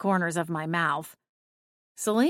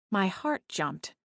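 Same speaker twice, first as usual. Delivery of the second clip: ordinary delivery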